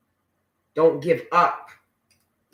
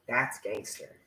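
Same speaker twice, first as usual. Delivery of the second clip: said again much softer, half to herself; soft echo; 4 semitones lower, 10 dB quieter than usual. Shown as a demonstration. Echoing her own remark softly to the viewers. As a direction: let it fall away under your breath. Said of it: "repeats her own remark softly".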